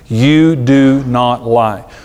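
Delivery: very slow, word by word; emphatic rule. Acted. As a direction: lay down the law, each word drawn out. Stating the house's cardinal rule firmly.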